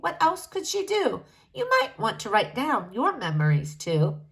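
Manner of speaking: usual speaking voice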